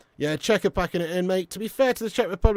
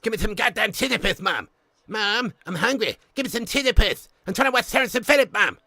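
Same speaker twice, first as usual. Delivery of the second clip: raised, whiny cartoon voice; bratty cartoon kid; 5 semitones higher than usual. Kid demanding food from Mum, bratty cartoon voice. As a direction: nag in a put-on brat voice.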